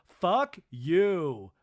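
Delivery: loud, punched mock insult; hammed-up angry retort; Lisa. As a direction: throw it out like a reality-show fight. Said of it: "And Lisa goes".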